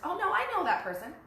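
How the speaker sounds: high, loud acted protest; insistent protest. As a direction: play someone indignantly sure of themselves. Acted client insisting against doubt.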